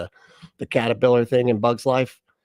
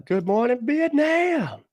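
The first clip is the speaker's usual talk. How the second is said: high drawn-out radio announcer call; Good Morning Vietnam; 14 semitones higher, much slower than usual. Retold or imitated. Greeting a viewer as an old-time radio DJ. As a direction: belt it like a DJ. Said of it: "famous quote impression".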